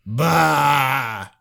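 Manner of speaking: scoffing bah; dismissive bah. Retold or imitated. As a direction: wave it off with a scornful bah. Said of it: "we say".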